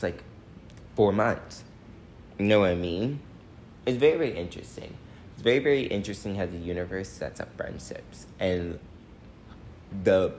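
normal talking voice

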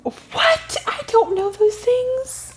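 high, drawn-out disbelieving protest; playful high-pitched indignation; mock bafflement. Real, not acted. Caught out by history question.

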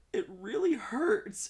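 put-on high, slow, pained child voice; little boy whimper. Uncle imitating his crying nephew, high and slow. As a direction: squeak it out like a hurting five-year-old. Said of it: "he said".

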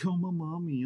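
imitating a homesick recruit, mock whiny; mock whining. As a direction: mimic a crybaby calling for his mommy. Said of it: "and you complaining".

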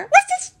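loud high yapping woof woof; dog barks. Acted. Toby barks at the teddy bear, loud and high. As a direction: bark like an excited little dog. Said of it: "barked Toby".

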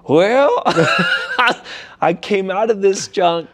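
high, laughing scoff; laughing disbelief. Retold or imitated. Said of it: "And I was like".

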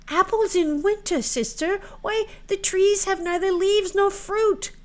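put-on very high, loud girlish protest; incredulous maiden. Marouckla objects to fetching apples in winter, incredulous.

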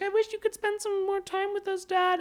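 put-on a wistful kid asking dad for time; imitating a child. Voicing daughter Jezebel to dad.

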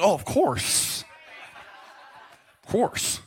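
drawn-out pretend knowing; mock knowing, trailing into breath; faking understanding. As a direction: play along as if you get it, slowly. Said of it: "kinda fake it till I make it".

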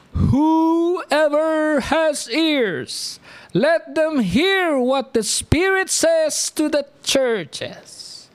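very slow, high, stretched declamation; drawn-out proclamation. Acted. Preacher telling congregation to take note, drawn-out proclamation. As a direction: proclaim it loftily, holding each word long. Solemn proclamation of scripture verse.

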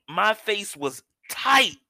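slow, high, with TIGHT punched; emphatic TIGHT. Real, not acted. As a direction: stretch out TIGHT for comic emphasis. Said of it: "When I say tight".

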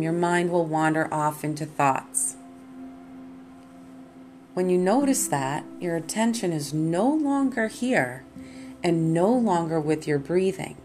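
ordinary talk, nothing marked

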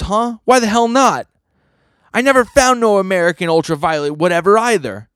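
high-pitched, startled comeback; incredulous Swordman. Acted. Swordman argues back at Denji over getting married, incredulous. Voices Swordman in manga recap.

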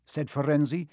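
ordinary delivery, nothing marked

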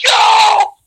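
high excited yell; crowd cheer. Retold or imitated. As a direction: whoop like a hyped crowd. Crowd reacting.

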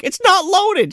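high whiny voice; mock whiny hero. Hero in the truck protesting, high and whiny. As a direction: whine it helplessly. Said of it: "he's like".

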